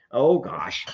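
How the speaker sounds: ordinary delivery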